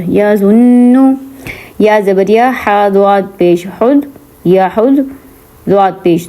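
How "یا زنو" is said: یا زنو with a nasal held ن; nasal ghunna. Shown as a demonstration. Teacher repeats the nasal ghunna. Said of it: "ناک میں آواز گئی".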